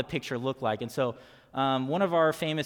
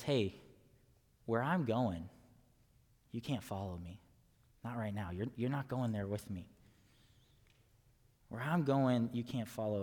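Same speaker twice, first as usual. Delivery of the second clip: softer, tender words from a departing friend; gentle Jesus voice; 4 semitones lower, 7 dB quieter than usual. Acted. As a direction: speak it softly, like saying goodbye. Pastor voicing Jesus to disciples.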